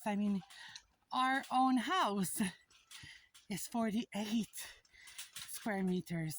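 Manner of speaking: usual speaking voice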